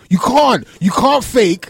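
forceful insistence in an argument; emphatic insisting. Real, not acted. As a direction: hammer it home. Insisting wrestling hurts.

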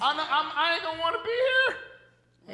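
hammed-up refusal; mock exasperated protest. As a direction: insist loudly, playing it for laughs. Acted protest, old self refusing.

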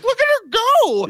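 very high, loud excited cry; excited squeal. Retold or imitated. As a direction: squeal with delight. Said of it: "She's like".

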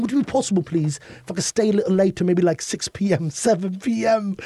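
high-pitched, flustered British pleading; British accent. Acted. Stranded hotel guest begging late checkout, pressuring British accent. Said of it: "we use our British accents".

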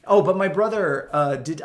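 normal talking voice

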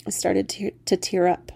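plain reading voice

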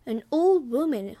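ordinary delivery

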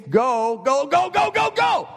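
rising panicked shout of go; frantic yelling. Retold or imitated. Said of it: "all I remember is me saying".

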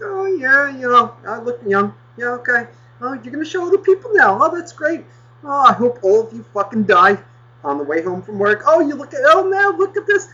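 high sarcastic sing-song. Retold or imitated. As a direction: drip sarcasm in a high mock voice. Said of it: "I was holding all my fucking anger in".

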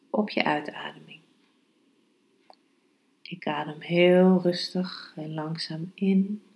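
calm, hushed meditation guidance. Acted. Leads a meditation, breath focus. (zachte, rustige meditatiestem)

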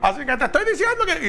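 high-pitched, indignant imitation of a ranting person; lightly hammed-up furious nag; mock angry yell. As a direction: imitate someone shouting at your back. Angry person yelling after you.

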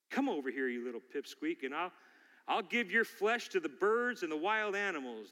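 ordinary talk, nothing marked

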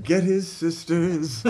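mock cry for help, slow and high; calling for help. Hippie women sending for a sick boy's sisters, mock urgent call.

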